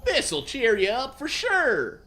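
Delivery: high, loud, slow cartoon voice; Sandy. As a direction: cheer a friend up in a squeaky drawl. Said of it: "Sandy said".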